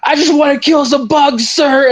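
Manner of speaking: high, strained soldier impression; defiant battle cry. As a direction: imitate a gung-ho soldier answering his officer. Acted defiant reply in battle.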